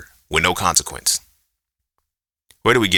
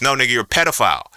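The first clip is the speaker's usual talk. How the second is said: usual speaking voice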